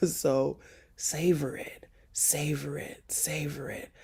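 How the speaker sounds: softly whispered, fading. Real, not acted. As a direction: breathe the words, savoring. Whispered repetition.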